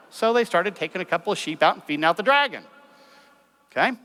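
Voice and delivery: ordinary talk, nothing marked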